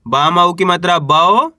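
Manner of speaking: ordinary talk, nothing marked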